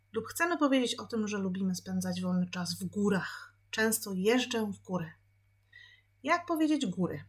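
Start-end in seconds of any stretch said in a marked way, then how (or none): none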